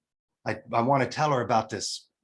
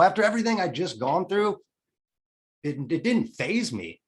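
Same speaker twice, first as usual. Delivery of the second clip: ordinary talk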